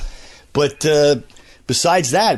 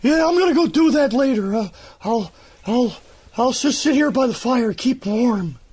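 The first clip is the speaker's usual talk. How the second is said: high put-on voice of the boat keeper; the boat keeper stalls, high and unhurried; lazy boat keeper; 11 semitones higher than usual. Ellis dodges his chicken chores, high character voice. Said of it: "it's Ellis, the boat keeper".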